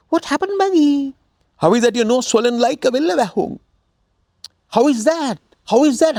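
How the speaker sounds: high, astonished gossipy neighbor; surprised neighbor woman. Acted. Neighbor woman asking Maggie about her swollen nose, high and astonished.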